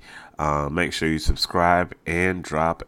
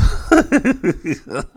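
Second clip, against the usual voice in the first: laugh; 5 dB louder than usual. Real, not acted. Laughing about nasal breathing.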